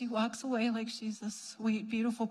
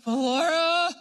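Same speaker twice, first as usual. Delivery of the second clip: loud, higher, odd imitation; weird voice; 4 semitones higher, 6 dB louder than usual. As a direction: blurt it in a strange put-on voice. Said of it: "in a weird voice".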